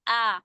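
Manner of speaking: आ held as an open long aa; long aa sound. Shown as a demonstration. Teacher isolating the आ in जाल. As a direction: stretch the vowel आ out clearly. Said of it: "last का जो sound आता है ना".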